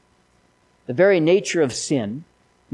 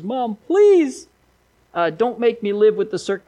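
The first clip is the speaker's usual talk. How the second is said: put-on much higher, louder little girl's wail; pleading crying girl; 8 semitones higher, 5 dB louder than usual. Five-year-old daughter begging her mother while crying. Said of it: "crying and bawling and screaming".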